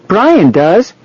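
put-on high-pitched protesting child; high little-kid voice; small boy. A narrator's reading. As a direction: argue back like a little boy. Small boy argues back.

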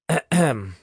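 pointed "ahem"; throat clearing. Acted. Chad interrupts the two whisperers, clearing his throat. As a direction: clear your throat for attention.

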